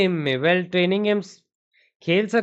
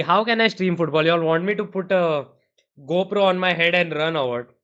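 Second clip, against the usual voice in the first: ordinary delivery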